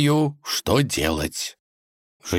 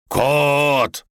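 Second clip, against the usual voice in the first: higher, louder, drawn-out call; 8 semitones higher, 5 dB louder than usual. A narrator's reading. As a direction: stretch the name and call harder. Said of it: "подбежал к дубу и позвал".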